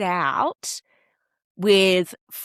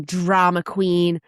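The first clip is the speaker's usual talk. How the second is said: ordinary delivery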